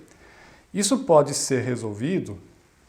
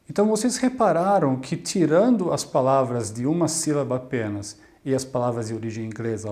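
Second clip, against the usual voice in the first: ordinary talk, nothing marked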